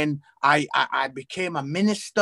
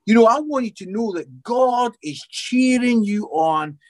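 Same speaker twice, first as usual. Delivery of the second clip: usual speaking voice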